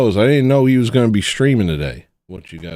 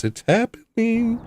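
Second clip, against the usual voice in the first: much higher, slow and drawn out; imitating Lawrence; 13 semitones higher, 4 dB quieter, slower than usual. Retelling Lawrence at the big moment, high and drawn out. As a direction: stretch it out in a high voice. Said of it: "He says".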